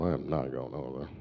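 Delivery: low, hushed aside to himself; quiet, low inner voice; inner thought. Retold or imitated. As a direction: mutter it like a private thought. Inner thought, under his breath.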